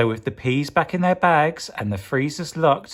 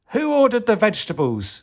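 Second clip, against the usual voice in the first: cheeky hero quip; 9 semitones higher than usual. Acted. Hero's quip.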